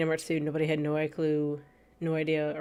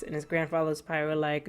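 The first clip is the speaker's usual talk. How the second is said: ordinary talk, nothing marked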